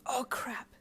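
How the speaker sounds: hissed. A narrator's reading. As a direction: hiss it in sudden alarm. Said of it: "you hissed".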